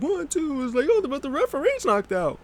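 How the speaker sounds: high-pitched voicing of a pin count; mock dismay. Acting out a pin with the referee knocked out, mock dismay. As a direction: squeal it lightly in a put-on high voice.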